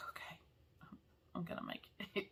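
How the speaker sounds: groggy whispered self-talk. Retold or imitated. Waking at four thirty after a long drive, whispered. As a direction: breathe it out, barely voiced. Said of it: "and I was like".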